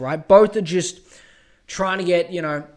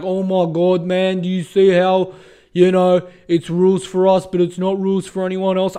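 mock anti-masker; 5 dB louder than usual. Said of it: "anti-mask people are just like".

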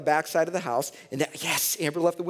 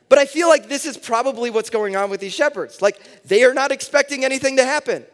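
preaching at full force; 9 semitones higher, 9 dB louder than usual. Acted. Preaching at high energy.

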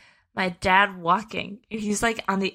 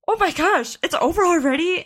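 high-pitched surprised exclamation; astonished, high re-enacted reaction; retold amazement; 10 semitones higher, 4 dB louder than usual. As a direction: act out disbelief, voice jumping up. Graduates amazed ceremony ended so quickly.

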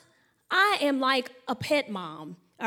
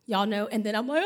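ordinary delivery

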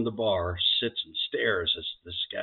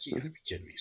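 softer, muttered disbelief; stunned undertone; 5 semitones higher, 9 dB quieter, much faster than usual. Acted. Groom hearing about Tiger again, muttering in disbelief. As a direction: mutter it under your breath. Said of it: "And he's like".

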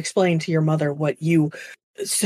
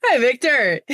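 bright, high, hammed-up greeting; the wrong take, a cheery hello the father would never give; overdone cheerful hi; too chipper hello; 7 semitones higher, 4 dB louder than usual. Shown as a demonstration. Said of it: "Not like that".

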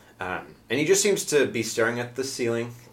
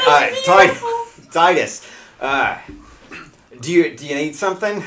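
put-on a light Australian accent, higher and louder; stammering Australian accent, pitched up; 6 semitones higher, 7 dB louder than usual. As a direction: ask in a hammed-up Australian accent. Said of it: "he's gonna be doing an Australian accent".

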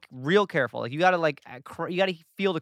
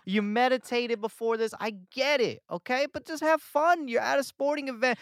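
higher, louder nagging at the LA crowd; higher hammed-up scolding; mock scolding; 7 semitones higher, 5 dB louder than usual. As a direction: scold a dull crowd, exasperated.

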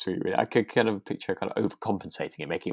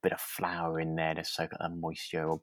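whisper; 3 semitones lower, 9 dB quieter than usual. Real, not acted. Aside about adding flour.

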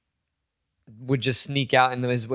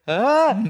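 high, loud mock yelp; imitated falling scream; jumping yelp; 12 semitones higher, 5 dB louder than usual. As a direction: cry out as if leaping off a ledge. Imitating friend's cry while jumping.

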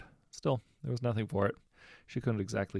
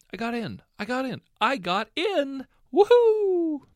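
higher and higher, louder each time, ending in a shriek; ecstatic squealing; 11 dB louder, slower than usual. A narrator's reading. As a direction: she just got in everywhere, let it burst out. Girl celebrating her acceptance letters.

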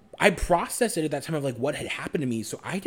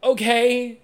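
high, raised-voice reluctant okay; reluctant giving in; 10 semitones higher, 5 dB louder than usual. Retold or imitated. Recalling agreeing to what they didn't want, reluctant. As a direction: give in unwillingly, pitched well up.

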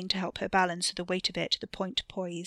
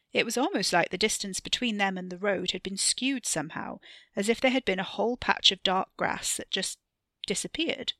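usual speaking voice

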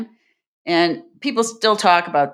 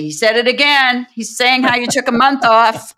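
higher, louder mock alarm; passing on the gossip, pitched up; acted tattling report; 6 semitones higher, 5 dB louder than usual. Colleagues reporting his repeated story to her, mock-alarmed. Acts out people writing to tell her.

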